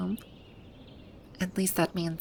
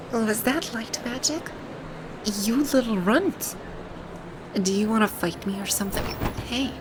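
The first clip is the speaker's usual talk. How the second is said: indignant anger. Acted. Ruler to the one who pulled them away, indignant anger. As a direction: bristle at being pulled away.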